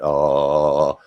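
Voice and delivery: low, open "ah"; deep, sustained chest note. Shown as a demonstration. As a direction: drop the voice down to the chest. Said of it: "if you practice doing that".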